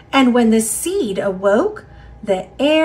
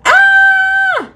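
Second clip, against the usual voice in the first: high shrieking scream, louder; goblins scream; 8 dB louder than usual. Acted. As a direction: shriek in fright. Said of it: "screamed the goblins".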